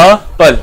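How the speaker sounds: एप्पल broken into "अ" and "पल", the opening vowel set apart; teacher splits एप्पल to show its vowel start; word split into sounds. Shown as a demonstration. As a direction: isolate the first vowel, then finish the word. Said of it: "एन का sound जो है वो हमें दे रहा है".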